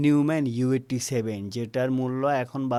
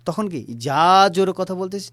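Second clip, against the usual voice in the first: louder; 5 semitones higher, 5 dB louder than usual. Shown as a demonstration.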